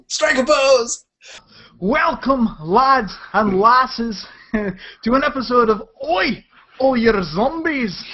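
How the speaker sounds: bad Scottish accent, slow. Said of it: "this horrible, godforsaken Scottish accent".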